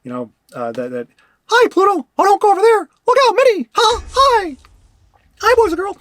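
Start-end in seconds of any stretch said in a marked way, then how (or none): 1.5-6.0 very high, loud cartoon mouse voice from the back of the throat; Mickey. Shown as a demonstration. Streamer demonstrating a cartoon greeting, high Mickey voice. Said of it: "So Mickey is back of the throat".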